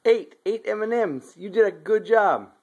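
put-on high, bright praise for a toddler; sing-song praise, slow and high; kindergarten teacher voice. Praising a small child counting.

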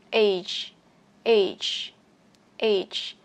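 "Age" said singly, each ending in an audible j sound; final G released. Shown as a demonstration. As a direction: let the last sound of each "Age" burst out. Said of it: "Như phiên âm ở đây".

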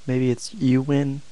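normal talking voice

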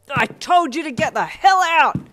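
loud, high, slow furious roar; enraged drawn-out shout; bellowing. Acted. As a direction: bellow like a drunk, menacing dad. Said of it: "your dad bellowed".

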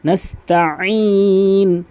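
নাস্তাইন slowly with a throat আইন, not a glottal stop; ain from throat. Shown as a demonstration. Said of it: "আইনে উচ্চারণ করতে হবে".

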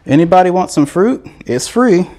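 joking sales pitch to viewers over fake fruit; mock hawker call. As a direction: hawk the free fruit, laughing at the end. Jokingly offers the home's display fruit.

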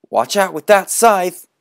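put-on high, loud alarmed boy's voice; high boy's alarmed cry; warning shout. Boys warn about the scythe.

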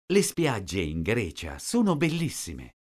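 ordinary talk

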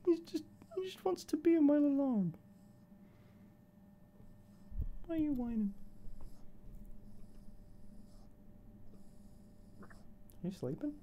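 high, soft cooing to a sleepy puppy. Real, not acted. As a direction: coo gently so he can doze.

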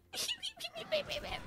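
high, small whimpering squeaks; tiny high-pitched hurt noises, put on; mock whimpering. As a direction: squeak softly like a wounded little creature. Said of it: "That's the exact noise I'd make".

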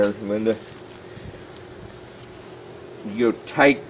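ordinary talk

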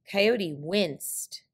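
ordinary delivery

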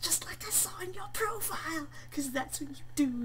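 high, loud excited yelling; gleeful outburst. Real, not acted. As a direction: burst out in mock triumph. Gleeful reaction to game text.

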